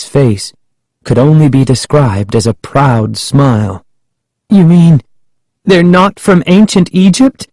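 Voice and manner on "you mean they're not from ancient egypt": high, horrified question; Sari crying out. A narrator's reading. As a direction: ask it aghast, pitched high. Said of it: "Sari cried".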